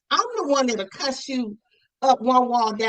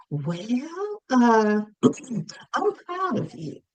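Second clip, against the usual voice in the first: mother's way of talking; 3 semitones higher than usual. Retold or imitated. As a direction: gently imitate a mother pausing before praise. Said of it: "that's how she talked".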